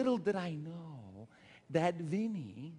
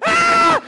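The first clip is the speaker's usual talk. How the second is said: very high, loud mock shriek; women's scream; 23 dB louder than usual. Preacher showing daughter how women react, mock scream. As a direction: caricature a woman's shriek of excitement. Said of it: "Women are like".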